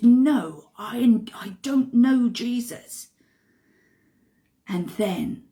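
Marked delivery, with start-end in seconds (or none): 0.0-3.1 loud, slow, upset denial; angry denial. Acted. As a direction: snap back, rattled and cornered. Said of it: "Peter gets very angry and upset".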